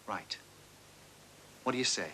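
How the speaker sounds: normal talking voice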